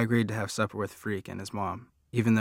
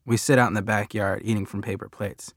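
ordinary delivery, nothing marked

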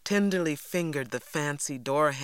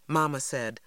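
usual speaking voice

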